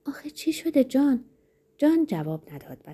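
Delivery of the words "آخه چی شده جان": high-pitched bewildered question; acted astonishment, not really muttered; astonished mutter. A narrator's reading. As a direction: ask in puzzled surprise, voice raised in pitch. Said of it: "لنینا حیرت زده زیر لب گفت".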